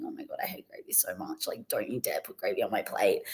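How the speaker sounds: hushed, breathy panic to herself; a private, terrified whisper; whispered inner dread. Retold or imitated. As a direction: whisper it like a secret horror. Dreaded inner thought at friend's.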